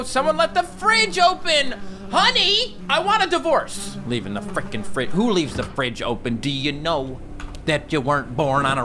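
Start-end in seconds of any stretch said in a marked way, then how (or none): 0.0-4.0 high, loud comic complaint; mock spousal yelling. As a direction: holler high at an imagined spouse. Shouts to imagined spouse.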